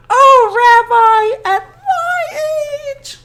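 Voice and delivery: very high, bashful squeal; high coy old woman's squeal; flustered granny. Acted. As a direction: giggle it like a blushing old woman. Baba Brena flustered.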